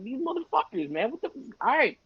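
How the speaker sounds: high-pitched retold exasperation; exasperated. To himself about the band kids, mock exasperated. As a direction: act out giving in under protest.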